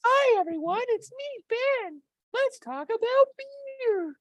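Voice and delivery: absurdly high squeaky falsetto. Pretend. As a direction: put on a tiny squeaky cartoon voice, stretching the last words. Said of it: "Something squeakier".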